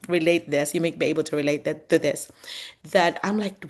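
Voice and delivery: ordinary talk, nothing marked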